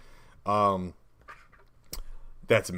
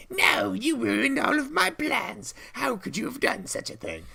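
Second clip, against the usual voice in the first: foiled witch shrieking over her ruined plans. Acted. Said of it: "That actually helps the witch voice".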